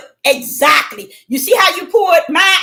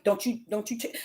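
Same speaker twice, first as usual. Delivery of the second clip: very soft, low rebuke; voice of God; 6 semitones lower, 15 dB quieter than usual. Retold or imitated. God questioning the upset preacher, hushed and low. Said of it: "he was telling me".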